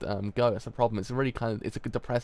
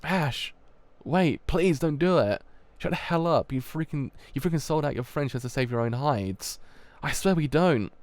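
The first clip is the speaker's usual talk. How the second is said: loud, frantic pleading and snapping back; cornered men begging an angry gunman; desperate yelling; 4 semitones higher, 5 dB louder than usual. Acted. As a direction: shout in panic at each other.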